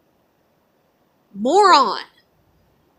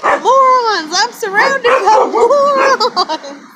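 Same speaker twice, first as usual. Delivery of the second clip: much higher, louder, slow put-on grumpy cartoon bulldog bellowing; 9 semitones higher, 6 dB louder, much slower than usual. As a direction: ham up the exasperated cartoon dog yelling "morons". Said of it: "that fucking bulldog's like".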